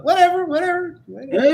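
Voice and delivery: high sing-song whatever; dismissive. Real, not acted. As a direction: brush it off with a high whine.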